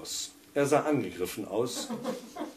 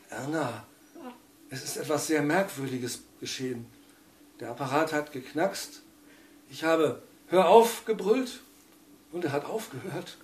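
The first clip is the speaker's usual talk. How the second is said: hoarse voice; 5 semitones higher than usual. A narrator's reading. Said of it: "rief mit heiserer Stimme".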